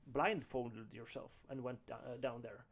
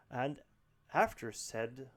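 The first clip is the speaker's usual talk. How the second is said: normal talking voice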